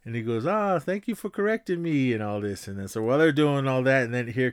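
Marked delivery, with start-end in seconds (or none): 0.5-2.1 put-on high, lightly accented "thank you for correcting me"; put-on Cameroonian accent. Disguised student thanking Beeks, light Cameroonian accent. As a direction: thank him politely in the fake student voice. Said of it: "exchange student from Cameroon".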